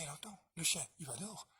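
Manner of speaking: usual speaking voice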